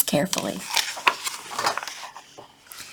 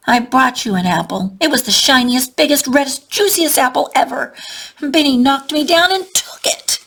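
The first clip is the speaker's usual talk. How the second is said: put-on upset child voice; 6 semitones higher, 4 dB louder than usual. A narrator's reading. Upset child tells his story.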